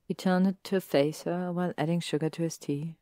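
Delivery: usual speaking voice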